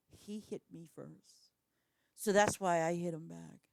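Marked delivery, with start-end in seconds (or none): 0.1-1.5 a hint of a kid's whine, soft and slow; muttered childish excuse; mocking kid voice. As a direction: lightly mimic a schoolkid's excuse. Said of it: "in school, like, whatever".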